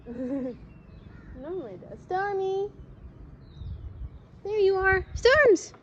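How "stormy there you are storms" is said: much higher, loud happy call; yelling across the room. Real, not acted. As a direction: shout down to a pet below. Calling the cat from balcony.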